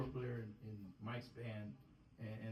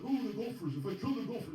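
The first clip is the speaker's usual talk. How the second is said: louder, higher hammed-up movie line; mock movie-line quote; 9 semitones higher, 8 dB louder than usual. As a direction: toss out the film quote like a fan. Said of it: "citing movie lines".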